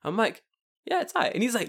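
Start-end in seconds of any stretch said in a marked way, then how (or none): 0.9-1.3 high-pitched, noncommittal shrug of a reply; imitating grudging, so-so approval; underselling. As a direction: play it down in a high voice. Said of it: "I'm still underselling".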